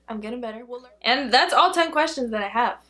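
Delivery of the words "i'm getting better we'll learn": much softer, half to herself; quiet repeat. Shown as a demonstration. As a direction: echo it quietly under the breath.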